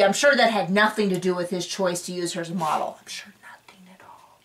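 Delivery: dry sarcastic whisper. Real, not acted. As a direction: whisper it with a knowing sneer. Sarcastic aside about producers.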